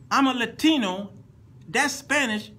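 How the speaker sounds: high, loud, emphatic reenactment; acted protest. Acts guitarist protesting the translation.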